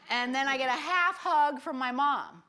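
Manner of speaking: normal talking voice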